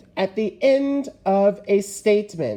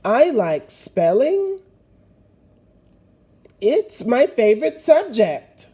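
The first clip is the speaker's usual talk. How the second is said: reading aloud to class; 3 semitones higher, 4 dB louder than usual. Acted. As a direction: read it out clearly for them to follow. Reads the paragraph aloud.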